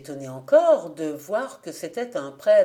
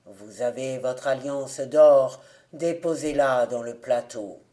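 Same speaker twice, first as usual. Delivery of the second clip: stern beadle; 7 semitones lower, 3 dB louder than usual. A narrator's reading. Beadle demands the wedding ring.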